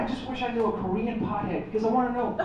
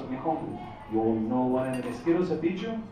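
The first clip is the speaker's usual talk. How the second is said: put-on Mexican dad accent; 8 semitones lower, 6 dB quieter than usual. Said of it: "speaks with a wicked accent".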